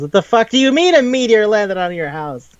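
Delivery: high, disbelieving what-the-fuck; incredulous outburst. Retold or imitated. Kazuma hears a meteor hit her house, incredulous. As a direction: Kazuma can't believe what he's hearing.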